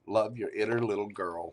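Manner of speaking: usual speaking voice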